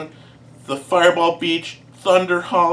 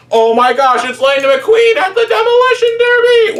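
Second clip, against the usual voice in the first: much higher, louder shocked shout; excited shout; 14 semitones higher, 5 dB louder than usual. Retold or imitated. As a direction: scream it like a stunned fan. Crowd spots Lightning McQueen.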